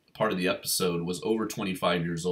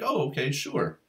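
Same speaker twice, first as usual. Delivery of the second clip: dismissive and laconic; 5 semitones higher than usual. Retold or imitated. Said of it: "so dismissive and just so laconic".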